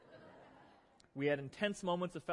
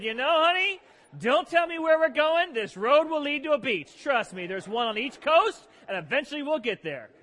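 hammed-up know-it-all husband; men in the room answering their wives, hammed up; mock husband voice; 8 semitones higher, 8 dB louder than usual. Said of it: "Every one of you just said".

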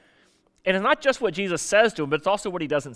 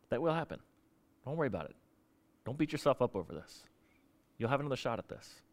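much softer, lower tender comfort; 7 semitones lower, 11 dB quieter than usual. Retold or imitated. Jesus comforting guilty Peter, soft and tender. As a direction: soothe him, nearly hushed.

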